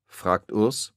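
plain reading voice

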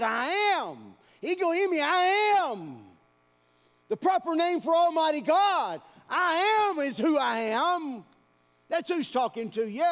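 loud, high, ringing proclamation; preaching at full force. Acted. Preacher proclaiming God's name to the church, at full force. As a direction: thunder the divine name out.

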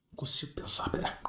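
breathless nervous whisper; hushed panicky whisper. Real, not acted. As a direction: whisper it, too rattled to think. Nervous streamer loses composure.

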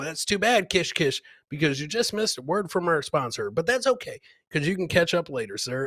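ordinary talk, nothing marked

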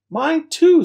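very high, loud squeal of agreement; excited chime-in. A narrator's reading.

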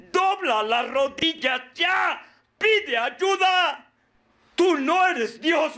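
high, drawn-out shouts of exhortation; fiery preaching. Acted. Godfather orders the addict to kneel, fiery preaching. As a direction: build to a slow, high shouted command.

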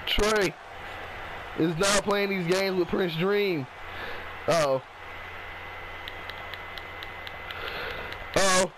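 lively ringside play-by-play; wrestling commentator. Acted. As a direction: call the match as it happens. Live play-by-play of the match.

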